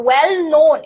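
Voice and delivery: draws out well known slowly; teacher slowly stresses well known as the example; slow stretched example. Shown as a demonstration. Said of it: "teacher stresses example term".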